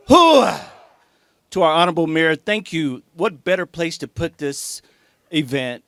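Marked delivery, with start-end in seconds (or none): 0.1-0.6 shouted military Hua, high and loud; loud gung-ho soldier. Real, not acted. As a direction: bark it even bigger. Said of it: "we gotta get excited".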